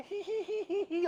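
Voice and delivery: high mock geisha giggle; mock girlish tee-hee; imitating a giggle. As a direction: titter like a coy little girl. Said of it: "Marissa's just giggling away".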